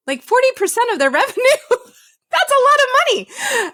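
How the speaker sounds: high, incredulous, laughing; real astonishment with a laugh; amazed. Real, not acted. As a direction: marvel at the huge figure, pitch soaring. Amazed at the revenue figure.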